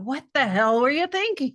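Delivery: a mild mock parental scold, pressed and loud; scolding parent to a kid. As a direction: let the angry parent come out. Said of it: "the parent comes out".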